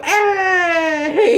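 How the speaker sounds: loud, high yell with a laugh; sudden shout. Acted. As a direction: yell it out to startle the dog. Yells at the dog.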